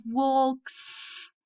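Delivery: we have walks, slow and drawn out; slow demo. Shown as a demonstration. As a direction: stretch we have walks slowly. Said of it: "how to pronounce the last sound".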